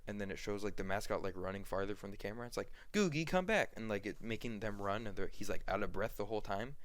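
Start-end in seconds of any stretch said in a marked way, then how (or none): 2.9-3.7 put-on higher-pitched kid calling someone back; lightly acted call across a distance; mock calling back. Kids calling mascot back.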